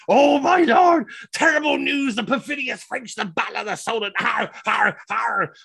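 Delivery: high, hammed-up wail of bad news; over-the-top grovelling herald; melodramatic messenger. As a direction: start in a shout, then splutter on in mock distress. Messenger throws himself down, reporting disaster.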